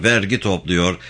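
usual speaking voice

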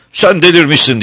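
high, loud furious shout; angry cry. A narrator's reading. Star child rejects the beggar woman, furious shout. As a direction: fling it at her in a rage. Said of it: "diye öfkeyle haykırdı yıldız çocuk".

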